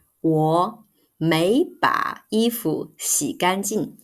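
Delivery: 我没把衣服洗干净 said slowly, word by word; slow model sentence. Shown as a demonstration. Teacher modelling the correct sentence slowly. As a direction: spell out 我没把衣服洗干净 slowly for learners. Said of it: "We need to say".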